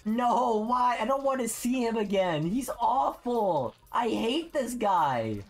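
high, slow mock-crying whine; whiny complaint. Real, not acted. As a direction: whine like you're about to cry. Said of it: "You're gonna make me cry".

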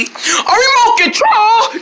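high, drawn-out delighted squeal; excited cry. Pretend. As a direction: exclaim it slowly and way up high. Others greet the pretend remote excitedly.

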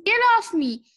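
high, loud protest; urgent pleading. A narrator's reading. Mister Watson pushes at Mercy, urgent pleading. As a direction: plead to be freed at once. Driver begs pig to move.

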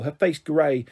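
ordinary delivery, nothing marked

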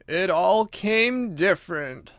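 thoughtful. A narrator's reading. Mock Turtle repeats Alice's words, slow and thoughtful. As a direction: mull over each word. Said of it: "repeated thoughtfully".

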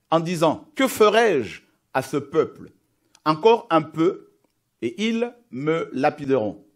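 fervent, emphatic Bible reading; dramatic scripture reading. Acted. Preacher reading Exodus seventeen to the church, dramatic. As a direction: read the passage like a sermon.

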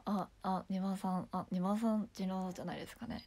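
low, hesitant made-up voice; put-on low timid voice. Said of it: "こういう声作ってる人いる".

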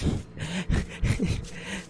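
quiet, breathy wheezing laugh; startled laugh. Real, not acted. Player who got spooked by the game, relieved laugh. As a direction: laugh it off softly after a scare.

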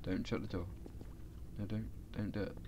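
soft, low pleading; quiet worried pleading; quiet plea. Real, not acted. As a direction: beg under your breath. Pleading with character at door.

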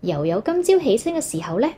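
usual speaking voice

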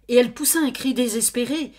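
narration rising fast and high; urgent storyteller build; heightened narration. A narrator's reading. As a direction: push the line up with alarm.

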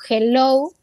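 hello with a strong throaty J-like H at the start; H as Spanish J. Shown as a demonstration. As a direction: say "hello" with the H sounded like a Spanish jota. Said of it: "que decirla como J, porque así suena la H". ("hello" con la H pronunciada como J)

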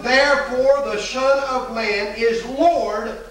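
slow it right down to land the verse; slow scripture quote. Acted. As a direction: quote drawn out slowly, word by word. Preacher quotes Jesus' verdict.

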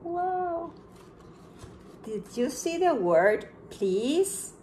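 ordinary talk, nothing marked

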